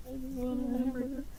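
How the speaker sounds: very high, tiny, soft squeak; mousy voice. Retold or imitated. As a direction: barely squeak it out like a mouse. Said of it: "she's talking like a mouse".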